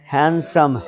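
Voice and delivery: हैंडसम with silent d, 'han-sum'; correct pronunciation. Shown as a demonstration. Teacher translating good-looking boy, correct pronunciation. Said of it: "हैंडसम नहीं".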